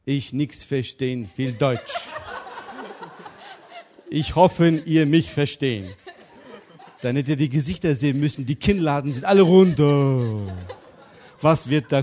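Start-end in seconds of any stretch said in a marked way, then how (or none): 0.0-5.9 broken-German newcomer. Acted. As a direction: play the foreigner who barely speaks German, tongue in cheek. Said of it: "hab so begonnen".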